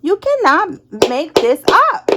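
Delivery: slow amazed repeat with a rising end; drawn-out disbelief. Shown as a demonstration. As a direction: savour each word like you can't believe it. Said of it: "I'm telling you".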